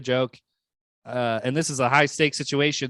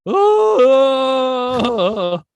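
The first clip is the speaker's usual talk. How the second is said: a sudden very high, loud "Oh"; high exclamation; 11 semitones higher, 8 dB louder than usual. Retold or imitated. Retelling the host's catchphrase, a loud high yelp. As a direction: squeal it out in a big high yelp. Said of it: "He goes".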